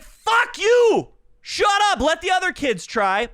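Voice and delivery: high, loud pretend yell; mock furious shout. Comic shouting at the classmate who answers everything. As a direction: blow up at the know-it-all kid. Comic imagined outburst at kid.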